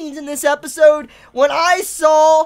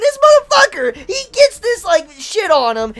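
furious outburst; 6 semitones higher, 6 dB louder than usual. Real, not acted. Raging about the character.

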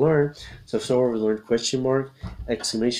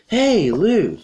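loud, high, drawn-out calls; stretched-out hollering of names; calling for attention; 11 semitones higher, 5 dB louder, much slower than usual. Acted. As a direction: hail friends from across the way. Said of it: "trying to get their attention".

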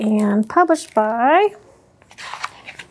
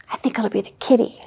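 fast excited whisper; 5 semitones lower, 5 dB quieter, faster than usual. Acted. Guessing who is at door.

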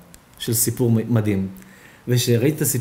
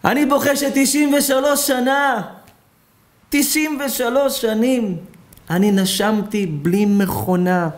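much higher, slow, lamenting plea; tearful old man; 8 semitones higher than usual. Acted. Old man weeping to the doctor about his years of breath, high and slow. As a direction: cry out the years of free breath.

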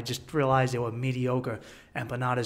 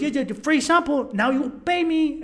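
high-pitched insistent tout impression; Colombian street hustler; 10 semitones higher than usual. Hustler repeating his demand, high imitated voice. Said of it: "And he goes".

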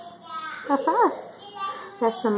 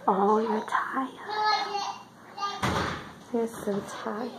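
soft soothing; 7 dB quieter, slower than usual. Real, not acted. Soothing tired baby.

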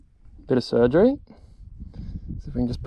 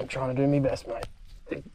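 ordinary delivery

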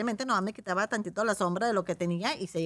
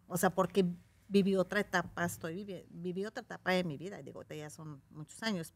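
ordinary talk, nothing marked

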